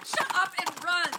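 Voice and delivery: loud, high yell to flee; terrified high shout; panicked shout. Acted. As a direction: scream at them to run. Fleeing the headless rider.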